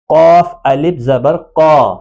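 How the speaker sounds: "قا" heavy and rounded after spelling it out; thick alif. Shown as a demonstration. Teacher spelling an example, "قا" with thick alif. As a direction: spell it, then hold "قا" full-mouthed. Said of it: "یہاں پر الف بھی موٹا پڑا جائے گا".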